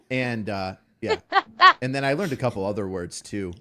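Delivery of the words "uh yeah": ordinary delivery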